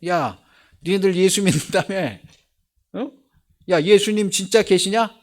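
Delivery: loud, high jeering call; a mocking outsider shouting his questions; taunting outsider. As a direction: heckle the believers like a street sceptic.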